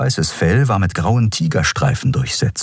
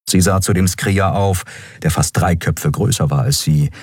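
ordinary delivery

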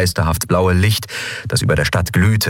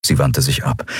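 ordinary delivery

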